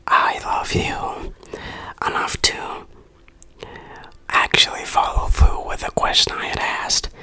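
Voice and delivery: normal talking voice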